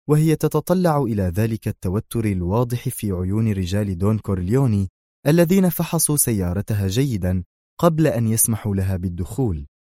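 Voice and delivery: ordinary delivery, nothing marked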